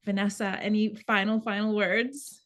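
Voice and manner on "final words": ordinary talk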